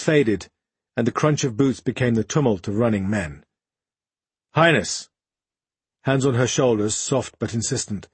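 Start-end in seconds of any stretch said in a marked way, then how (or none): 4.5-5.1 louder, urgent cry of Highness; alarmed call. Acted. Sergeant finds the queen, calling out urgently.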